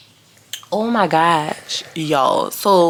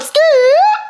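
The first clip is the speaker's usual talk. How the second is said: loud, very high playful squeal; giddy piercing yelp; 5 dB louder than usual. Real, not acted. Playful squeal after song snippet.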